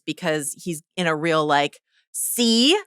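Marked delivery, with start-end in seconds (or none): 2.1-2.8 gloating, raised-voice I-told-you-so; Ormond, smug. Acted. Host acting Ormond boasting to his doubter, smug. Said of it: "So smug about it".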